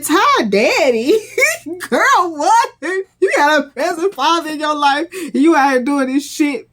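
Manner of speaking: high, loud mock disbelief with a laugh; incredulous outburst. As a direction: squeal it in scandalised disbelief. Acting out her shocked outburst.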